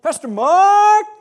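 high, slow, loud cheer; mock fan shout. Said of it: "And they're shouting out".

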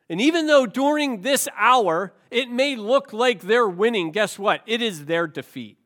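rousing preaching. Real, not acted. Sermon climax continues.

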